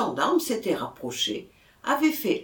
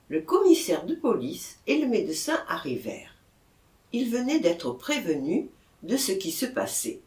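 plain reading voice

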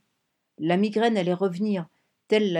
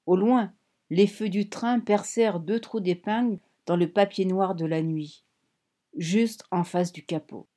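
ordinary delivery, nothing marked

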